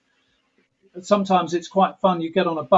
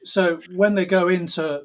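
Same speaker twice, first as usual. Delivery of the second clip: normal talking voice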